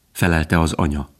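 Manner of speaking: plain reading voice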